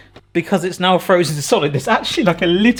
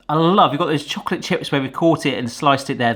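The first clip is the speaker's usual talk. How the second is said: usual speaking voice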